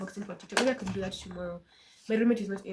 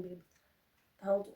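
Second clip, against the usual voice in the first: normal talking voice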